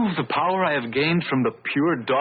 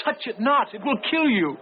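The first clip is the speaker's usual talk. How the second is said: higher mock cry of warning; retold cry; 10 semitones higher than usual. Giovanni recalling Beatrice's warning, a high mock cry. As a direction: echo her warning cry, pitched up. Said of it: "you cried out".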